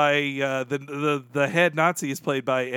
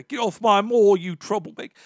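mock posh British yokel accent, louder and higher; 5 semitones higher, 3 dB louder than usual. Quoting the angry moor villager from the parody in mock British accent. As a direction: bark it in a hammed-up British accent. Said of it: "how British everything is".

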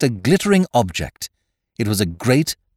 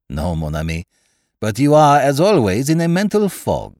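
put-on French-accented detective, rising higher and louder; Poirot, teasing; 5 semitones higher, 5 dB louder than usual. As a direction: chide a friend in a French accent. Poirot teases his friend.

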